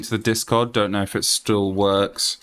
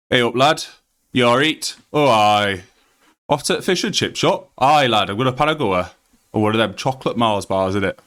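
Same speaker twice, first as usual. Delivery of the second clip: broad Yorkshire; 3 semitones higher than usual. Shown as a demonstration. Streamer showing chat his home Yorkshire accent. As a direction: lay the Yorkshire on thick. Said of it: "and then I have Yorkshire".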